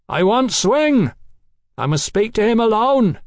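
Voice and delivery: demanding shout. A narrator's reading. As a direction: insist over the chaos. Mallory demanding over chaos.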